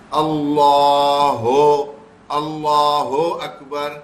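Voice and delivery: "اللہ" very drawn out, ending in "ho"; slow, stretched "اللہ" with an "o" ending; wrong takbeer. Shown as a demonstration. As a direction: show the mistake: "Allaa-ho akbar" for "اللہ اکبر". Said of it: "یہ غلط ہو جاتا ہے".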